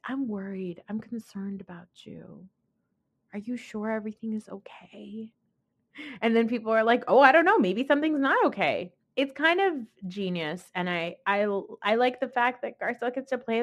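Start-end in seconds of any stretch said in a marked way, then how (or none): 0.0-5.3 soft, hushed fake worry; nasty-nice concern. Southern lady being nasty nice, softly concerned. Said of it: "Southern nasty nice thing to do". 7.1-8.9 higher, louder put-on doubt; mock doubtful alarm. People reacting to planted worry, mock alarmed doubt. Acted onlookers taking the bait.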